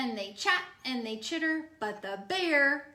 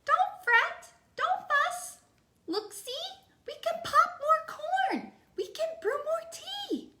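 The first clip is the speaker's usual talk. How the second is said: much higher tiny mouse voice; squeaky mouse. Acted. Mouse soothes the crying bear, high squeaky voice. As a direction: squeak it out to comfort a friend. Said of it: "Mouse squeaks".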